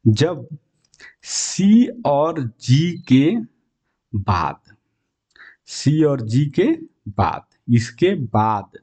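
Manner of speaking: slow, deliberate "after c and g"; teacher stressing a key rule slowly; slow emphasis. Shown as a demonstration. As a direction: spell out the rule word by word. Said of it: "ध्यान दीजिएगा".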